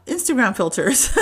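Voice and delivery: a laugh on "Instagram filters". Real, not acted. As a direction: laugh at own punchline. Punchline about her filters.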